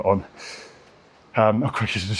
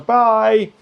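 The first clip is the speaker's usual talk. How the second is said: cheery goodbye; 8 semitones higher, 3 dB louder than usual. Retold or imitated. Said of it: "he's just going".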